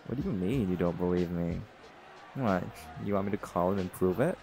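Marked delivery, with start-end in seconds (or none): none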